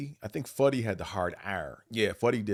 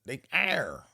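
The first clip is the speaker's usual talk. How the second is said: "They air." with a heavy hard R, higher; hard Buffalo R; 6 semitones higher than usual. Retold or imitated. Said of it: "Norm and Pooh got that hard air".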